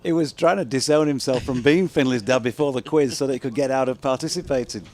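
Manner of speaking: amused teasing. Real, not acted. Teasing story told amid laughter.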